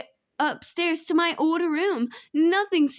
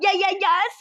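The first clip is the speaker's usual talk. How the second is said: louder, higher stuttered yes; stuttering; 4 semitones higher, 5 dB louder than usual. A narrator's reading. Guest answering the robot's secret offer, flustered stutter. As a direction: stammer it out in repeated bursts. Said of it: "cursing myself for stuttering".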